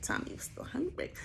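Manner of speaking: a high, breathy whisper; whispered aside. Real, not acted. Telling the crowd about Tommy's appetite in a high whisper. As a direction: whisper it like a secret.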